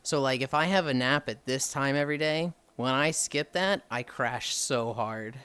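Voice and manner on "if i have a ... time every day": ordinary talk, nothing marked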